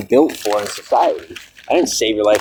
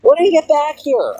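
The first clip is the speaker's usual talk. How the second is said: impatient call. Real, not acted. Impatient call to game character.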